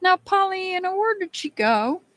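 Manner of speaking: high singsong call into the room. Real, not acted. Calling out for absent viewer.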